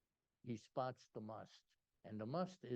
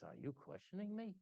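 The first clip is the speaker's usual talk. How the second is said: imitated official, higher-pitched challenge; Mister Authority; 9 semitones higher than usual. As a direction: challenge them as the man in charge. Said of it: "this is the way he talked".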